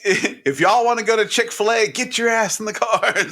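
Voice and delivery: laughing, lightly acted parent command; parent rounding up kids. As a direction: playfully order the kids to the car, amused. Acts a parent hurrying kids out.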